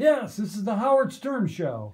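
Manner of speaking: loud, high show-opening shout; over-excited radio host. Shown as a demonstration. Trainer overloading the mic as a joke, excited and loud. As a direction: burst out like a hyped-up shock jock. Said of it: "But I'm not gonna talk like".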